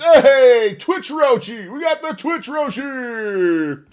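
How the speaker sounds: loud, high, stretched-out hello; excited greeting. Real, not acted. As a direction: hype up the new viewer. Greets a new viewer.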